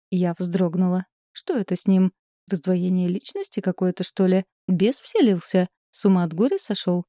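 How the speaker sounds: ordinary delivery, nothing marked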